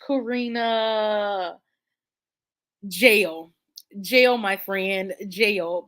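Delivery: slow, drawn-out sentence of jail; mock verdict. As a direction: hand down a playful judgment slowly. Verdict on a friend's choice.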